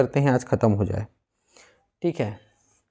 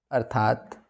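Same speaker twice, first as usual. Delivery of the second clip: usual speaking voice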